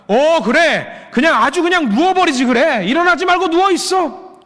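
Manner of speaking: high, sarcastic mock scolding; exasperated God. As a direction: tell them off in exasperation, pitch high. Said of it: "그러실 수 있습니다". (높은 목소리로 비꼬며 꾸짖는 말투)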